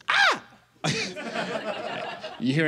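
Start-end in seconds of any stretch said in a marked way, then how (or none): 0.1-0.4 sharp high yelp, much higher and louder; a sudden shocked "Ah!" cry; upset African exclamation. Retold or imitated. Showing the crowd the sound Africans make when upset. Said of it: "and that sound is".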